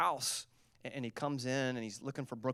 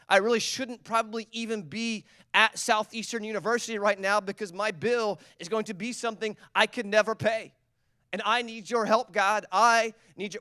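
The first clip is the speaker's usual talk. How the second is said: pleading prayer; 6 semitones higher, 6 dB louder than usual. Acted. Congregant praying aloud.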